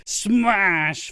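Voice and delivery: normal talking voice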